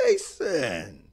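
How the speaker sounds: loud, surprised call of his name; mom's shout. Retold or imitated. Mom spots her son at the door, shouting. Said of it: "she was like".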